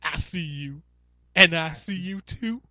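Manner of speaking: high, slow leering creep; creepy voice. Pretend. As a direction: draw it out like a lurking stalker. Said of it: "we've turned Paul into quite the fucking creep".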